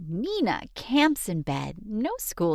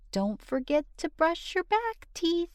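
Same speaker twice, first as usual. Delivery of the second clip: high parent reminder; 11 semitones higher, 4 dB quieter, faster than usual. Acted. Parent reminding child at bedtime.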